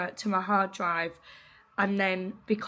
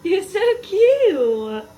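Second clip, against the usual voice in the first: dog voice; 9 semitones higher, 9 dB louder, much slower than usual. Real, not acted. Gushing at the puppy.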